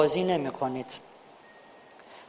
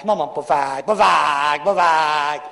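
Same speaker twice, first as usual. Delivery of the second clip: mock tantrum, louder, chanted; child tantrum; 3 semitones lower, 4 dB louder, much slower than usual. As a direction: imitate a kid stamping and chanting for a treat.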